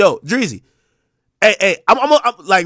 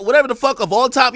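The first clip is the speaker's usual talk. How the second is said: usual speaking voice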